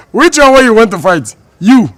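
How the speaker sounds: loud, indignant dare; confrontational challenge. Acted. Challenging him to fight.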